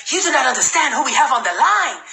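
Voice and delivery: mimicked African accent, hyping up the caller; Alassane Seck's African accent. Recruit hyping his sponsor to a guest, imitated African accent. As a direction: imitate an enthusiastic African speaker. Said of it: "He's African, right?".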